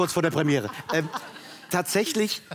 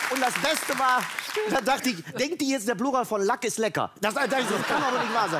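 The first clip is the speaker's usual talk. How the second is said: working himself up; 6 semitones higher than usual. Real, not acted. Telling friends about the Stöcker video, getting higher and more worked up. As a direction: build the rant up, half laughing. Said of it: "ich steigere mich".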